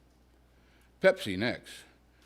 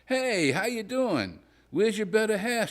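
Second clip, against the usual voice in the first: high, bubbly gossipy woman's voice; chatty woman friend; 11 semitones higher, 3 dB louder than usual. Acted. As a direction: gush like a nosy acquaintance, high up. Wife's friend greets him.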